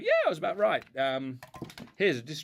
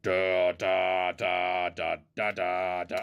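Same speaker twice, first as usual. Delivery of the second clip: rolling noises; 4 semitones lower, 4 dB louder, much slower than usual. Pretend. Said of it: "you can see how it rolls along now".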